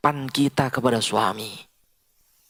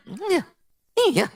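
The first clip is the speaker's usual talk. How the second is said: sweet yes. Shown as a demonstration. Wife answering her husband, high and sweetly. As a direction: coo it like a loving wife. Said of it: "Coba nanti praktek".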